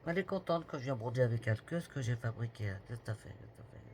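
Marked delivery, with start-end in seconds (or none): 3.3-3.8 whispered to herself, much softer; whispered self-scolding. Real, not acted. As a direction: breathe it under your breath at the mistake. Scolding herself over embroidery mistake.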